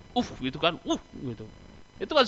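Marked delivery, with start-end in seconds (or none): 0.1-0.8 demo uff sigh. Shown as a demonstration. 0.8-1.5 demo uff sigh. Shown as a demonstration.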